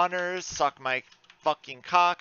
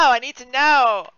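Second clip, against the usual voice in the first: loud and higher; panicked shout; 9 semitones higher, 8 dB louder than usual. Real, not acted. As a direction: yell in panic at the misclick. Misclick panic.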